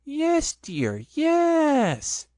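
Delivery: high, light imitation of Piglet, soothing; mother humoring a child by copying him; soothing mock Piglet. A narrator's reading. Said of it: "soothingly, and imitating Piglet's voice".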